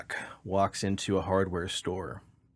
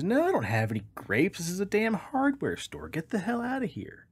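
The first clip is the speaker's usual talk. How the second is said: much higher, rising exasperation; losing it with the duck; exasperated shopkeeper; 11 semitones higher than usual. Acted. Manager losing patience.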